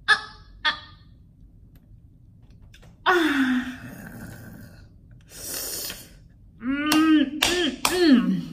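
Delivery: high, drawn-out pained groans; exasperated groan. Real, not acted. Groans as the student finally speaks up, in pained disbelief. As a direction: groan in disbelief at the late witness. Exasperated reaction to the kid's late testimony.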